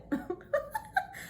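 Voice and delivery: squealing high-pitched laugh. Real, not acted. Showing viewers the hat and emoji, high giggle.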